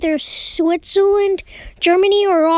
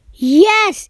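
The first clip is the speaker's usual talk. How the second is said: ordinary talk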